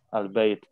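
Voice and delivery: "Al-bait" closed on t without the u; conversational dropped ending. Shown as a demonstration. Teacher allowing the everyday reading, final vowel dropped. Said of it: "nggak apa-apa dalam percakapan".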